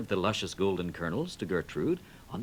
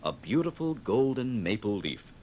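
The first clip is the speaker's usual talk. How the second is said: ordinary delivery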